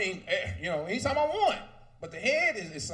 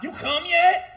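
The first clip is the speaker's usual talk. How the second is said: higher, loud nagging call; mock shouted question; 8 semitones higher, 10 dB louder, slower than usual. Partner impatiently shouting her question at him in bed.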